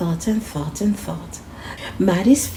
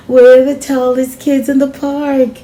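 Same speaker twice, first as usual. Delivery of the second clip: louder, higher proud call; proud cheer; 5 semitones higher, 8 dB louder than usual. Acted. Friend celebrating with Sophia atop the wall, loud and proud. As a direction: announce it to the whole park.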